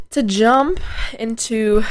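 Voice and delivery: "To jump into" with a cracking voice. Real, not acted. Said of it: "did my voice just crack".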